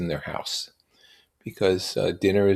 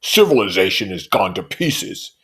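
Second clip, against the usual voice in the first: violent outburst; 7 dB louder than usual. A narrator's reading. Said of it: "broke out Tom violently".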